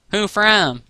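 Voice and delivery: high-pitched curious question. A narrator's reading. Applejack asks about the letter, curiously. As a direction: ask with open interest. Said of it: "A J asked curiously".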